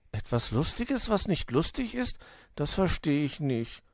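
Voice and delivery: high, puzzled little grumble; grumbling confusion. A narrator's reading. Borstel fails to get the joke, puzzled grumble. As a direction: query it with rising pitch. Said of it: "murrte der Igel".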